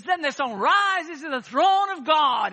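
high, loud revivalist climax; soaring mock pulpit shout; imitating American preacher. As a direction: mimic an Afro-American preacher at the peak. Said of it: "an Afro-American preacher".

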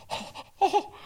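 startled high comic yelps; Brian Regan. Retold or imitated. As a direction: squeal like a stunned comic. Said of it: "he turned into Brian Regan".

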